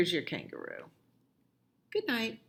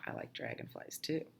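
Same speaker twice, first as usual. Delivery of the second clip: whisper; 2 semitones lower, 13 dB quieter than usual. Acted. Child whispers agreement.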